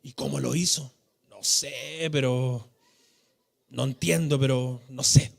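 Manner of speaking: low hushed voice of confused fishermen at night; puzzled disciples. Retold or imitated. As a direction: disciples puzzling over the miracle before sleep, low and soft. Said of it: "iban conversando".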